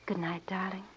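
soft, breath-light murmur; tender good night. Acted. Woman parts from her sweetheart at night, tender murmur.

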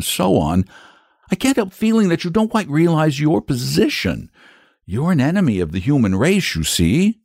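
put-on high-pitched boy, explaining carefully; earnest, worried boy. A narrator's reading. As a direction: reason with the dragon as an anxious boy. Boy figure in a story.

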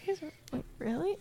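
very soft, high-pitched disbelief; incredulous aside. Retold or imitated. Reacting to two women overheard in a coffee shop, incredulous aside. Mimics own silent reaction to strangers' chat.

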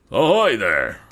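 loud, high hail; calling out. Acted. Officer hailing a submarine from his boat, loud call. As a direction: call across the water to another vessel. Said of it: "called an officer in the boat".

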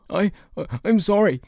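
put-on high, stammering boy; frightened cry. A narrator's reading. Beaten robber boy apologising to his attacker, frightened. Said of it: "the boy cried".

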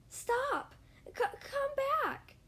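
high desperate crow squawk. Acted. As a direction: call out after the leaves, squawking. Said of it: "he squawked".

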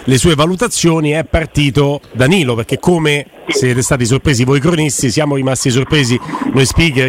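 ordinary talk

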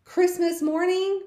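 loud, high exasperated disbelief; annoyed disbelief. Retold or imitated. Mom, annoyed, can't believe the rabbit died on Christmas morning. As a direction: throw up your hands at the timing. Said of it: "kind of annoyed".

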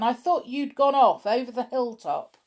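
put-on a man calling after kids walking away. A narrator's reading. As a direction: keep shouting after them. Dan calling after children.

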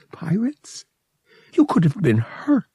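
put-on high, worried girl's voice; worried young woman, high; distressed. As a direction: fret over him, high and anxious. She looked distressed.

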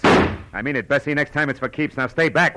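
loud, hard command to back off; stern warning. Acted. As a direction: warn her off the desk with force. Detective holds Bessie off the desk.

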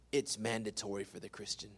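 low, hushed and grave; quiet emphasis. Real, not acted. Pastor stating the rule to Christians, quietly. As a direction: drop right down for weight. Same statement said quietly, then loudly.

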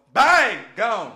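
explosive burst on each word; booming, abrupt exclamation; sudden loud bang. Acted. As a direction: punch it out like a blast. Acting out a sudden vanishing.

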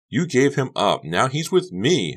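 high, loud assertion; insistent. A narrator's reading. Honey claiming Eric from Becca, insistent. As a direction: press the point hard. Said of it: "Honey insisted".

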